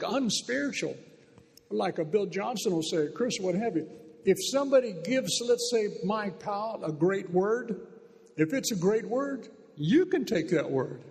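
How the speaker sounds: ordinary delivery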